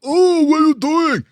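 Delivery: high, loud, slow cartoon gorilla yell. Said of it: "I was Bubba, big gorilla".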